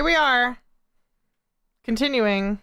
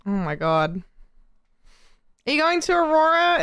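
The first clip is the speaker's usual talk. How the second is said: ordinary talk, nothing marked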